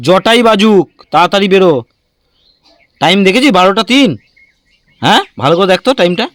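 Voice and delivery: loud, high-pitched parental telling-off; stern scolding. Real, not acted. Parent scolding child about time. (চড়া গলায় বকুনি)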